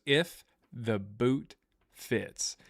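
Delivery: very slow, dragged-out "If the boot fits", every word stretched; mock-dramatic slow delivery; Woody's slow drawn-out line. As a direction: stretch "If the boot fits" out slowly, like a zoomed-in clip. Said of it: "he does this".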